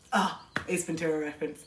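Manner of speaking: low, soft, offhand recognition; unbothered partner. Acted. Imagined partner hearing the noise from downstairs, quietly unfazed. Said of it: "they're just like".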